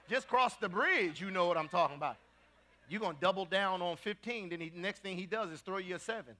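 usual speaking voice

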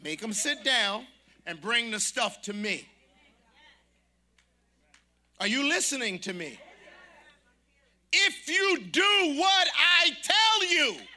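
full-throated pulpit preaching; preaching at full force. Real, not acted. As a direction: drive each phrase home to the congregation. Preaching build to climax.

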